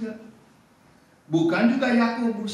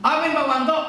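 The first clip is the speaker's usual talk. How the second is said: high, teasing call for an amen; calling on someone; 7 semitones higher, 4 dB louder than usual. Real, not acted. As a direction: summon a reply from the pews. Memanggil jemaat agar menjawab amin. (memanggil jemaat menagih amin, nada tinggi)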